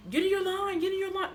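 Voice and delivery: high, loud mock yell; imitating yelling. Imitating students calling out to get in line, high loud mock yell. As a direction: bark the order in a high voice. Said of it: "They're just yelling".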